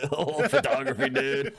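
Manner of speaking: high-pitched echo through laughter; mocking mimicry. Friend copying his self-imitation, laughing high-pitched mimicry. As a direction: laugh while parroting the dude voice.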